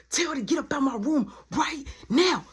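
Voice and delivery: high, emphatic acted order; mimicked exasperated outburst; heated demand. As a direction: punch out each of the last words. Acted demand repeated more forcefully.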